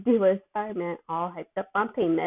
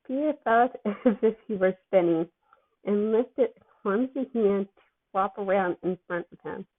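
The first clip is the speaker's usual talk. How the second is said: plain reading voice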